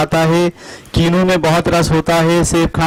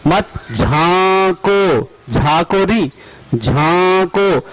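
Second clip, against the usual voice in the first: slow drill reading; slower than usual. Shown as a demonstration. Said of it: "reading drill sentence slowly".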